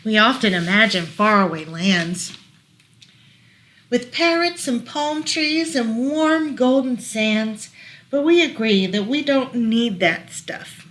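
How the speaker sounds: lilting rhyming read-aloud; reading to children. Acted. Parent reading a rhyming book to child, gentle lilt. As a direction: read the rhyme gently to a toddler.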